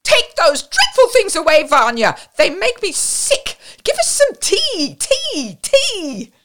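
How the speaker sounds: high, loud shrill orders; shrill it insistently over the din; piercing, bossy shouting; shrill, determined refusal. A narrator's reading. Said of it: "with a shriller voice and more determined refusal".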